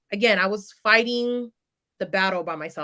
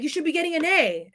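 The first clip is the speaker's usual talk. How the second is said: lightly voiced upset father, pitched higher; higher-pitched parental scolding, mildly done; upset dad; 7 semitones higher than usual. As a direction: insist like a displeased dad. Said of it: "my dad was upset".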